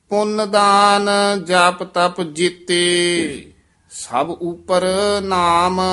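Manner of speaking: measured, slow chanting of scripture; slow gurbani recitation. Acted. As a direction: intone each line slowly like kirtan. Chants further verses.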